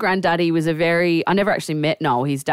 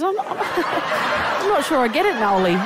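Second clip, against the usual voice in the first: higher, slow, stumbling confusion, half laughing; confused; 10 semitones higher than usual. Retold or imitated. Bloke admitting to Noel he's lost, confused. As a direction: play a baffled bloke through your own amusement. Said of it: "this bloke looks confused".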